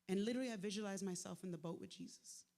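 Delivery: ordinary talk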